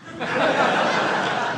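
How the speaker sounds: a real high, airy giggle; high laugh. Real, not acted. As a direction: giggle at your own joke. Laugh after the joke.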